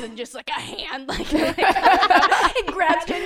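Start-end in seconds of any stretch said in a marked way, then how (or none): 1.1-3.2 laughing. Retold or imitated. Spooky story, laughing.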